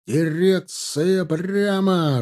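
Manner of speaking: high, very drawn-out order; carrying military command. A narrator's reading. New order ringing out over the troop, drawn-out command. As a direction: stretch the command to carry afar. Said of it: "разнеслась новая команда".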